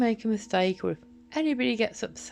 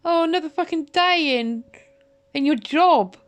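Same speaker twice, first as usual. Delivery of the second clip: an enraged outburst, much higher and louder; furious shouting; 5 semitones higher, 5 dB louder than usual. Real, not acted. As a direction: shout it, bitter and outraged, drawing the words out. Furious rant at Martin.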